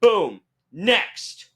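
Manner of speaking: exaggerated rapid-processing call; strict queue handler. Staff moving fans along, in an exaggerated strict call. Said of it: "they were, like, strict".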